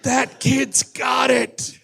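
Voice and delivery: slow, high, strained movie-star impression; Clint Eastwood. Co-host as the star approving the joke, slow strained impression. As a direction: draw out each word as grudging praise. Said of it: "how Clint Eastwood laughs".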